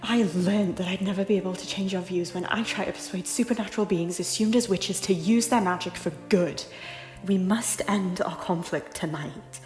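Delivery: loud, forceful pleading; impassioned argument. Acted. Lady arguing with her lord to end their conflict, loud and impassioned. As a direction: plead hard at full voice. Heated quarrel with tyrant lord.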